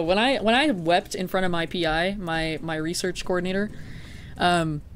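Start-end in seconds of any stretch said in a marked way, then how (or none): none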